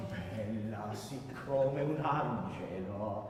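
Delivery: slow, low, drawn-out Italian line; outward declamation. Shown as a demonstration. Coach shows singer how it comes out, slow declamation. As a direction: declaim it slowly, selling each word. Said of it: "It has to come out".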